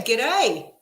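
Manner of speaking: loud, badly faked Australian accent. Streamer greeting an Australian viewer, bad Australian accent. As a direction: have a clumsy go at an Australian accent. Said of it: "That was a shit accent".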